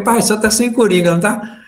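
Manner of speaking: excited teasing. Retold or imitated. Teasing father over cards.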